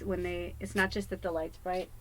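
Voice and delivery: usual speaking voice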